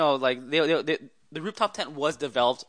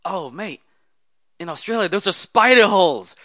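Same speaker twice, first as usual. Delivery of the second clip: loud, higher mock Australian voice; put-on Australian accent, building louder; Aussie accent; 7 semitones higher, 9 dB louder than usual. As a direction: imitate an excited Aussie, pitched well up. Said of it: "he says in his Aussie accent".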